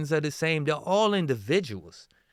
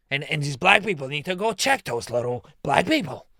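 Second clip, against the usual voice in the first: imitating Nick. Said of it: "why does he sound like that?".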